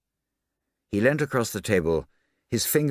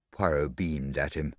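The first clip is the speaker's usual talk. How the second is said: ordinary delivery, nothing marked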